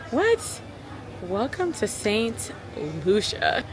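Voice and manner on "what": a high, amazed "What?"; squeaky shocked "What?"; stunned disbelief. Real, not acted. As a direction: gasp it out as if you can't believe the view. Seeing the balcony view.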